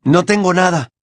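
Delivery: Harry shouting. A narrator's reading. Harry denying he has the prophecy, shouted. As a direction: call it out in defiance. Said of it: "gritó Harry".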